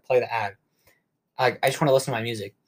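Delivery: ordinary delivery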